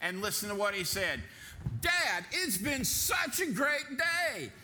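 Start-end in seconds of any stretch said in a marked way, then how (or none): 1.8-4.5 high, loud, drawn-out happy cry; joyful child's shout. Acted. Boy leaping into his father's arms, happy shout. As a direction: shout it like a thrilled kid hugging his dad.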